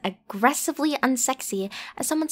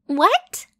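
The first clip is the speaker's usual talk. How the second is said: indignant what; 5 semitones higher, 6 dB louder than usual. Acted. Reacting to partner's look.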